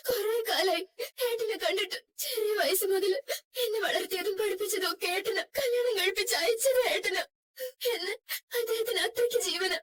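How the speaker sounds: high, tearful sobbing telling; weeping. Acted. Wife telling the driver why she cannot see her brother, sobbing. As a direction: cry through the story of her brother.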